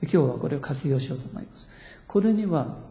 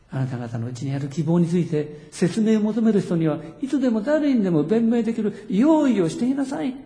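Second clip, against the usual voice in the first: rising to a high-pitched urgent exhortation; high, emphatic scripture exhortation; exhorting; 6 semitones higher than usual. Retold or imitated. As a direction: proclaim the command with a lifted voice. Quotes Peter's command to be ready.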